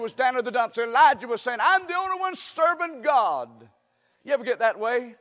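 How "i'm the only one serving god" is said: high-pitched, acted-out lament; high, aggrieved quote; self-pitying complaint. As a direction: protest in a raised pitch, feeling all alone. Voices Elijah's self-pitying complaint.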